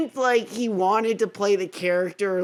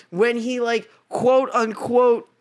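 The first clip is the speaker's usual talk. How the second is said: normal talking voice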